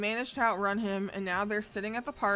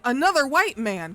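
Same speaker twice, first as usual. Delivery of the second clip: loud, higher exclamation; yelled; 5 semitones higher, 9 dB louder than usual. Retold or imitated. Yelling at the game as the foreigners charge, loud. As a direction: call it out loud and high. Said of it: "and I yelled".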